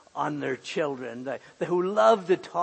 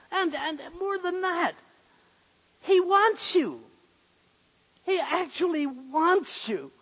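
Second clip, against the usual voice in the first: raised, pleading preacher's voice, much higher and louder; rising sermon fervour, higher pitch; fervent preaching; 9 semitones higher, 4 dB louder than usual. Acted. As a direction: press the point home to the congregation. Preacher urging God wants you.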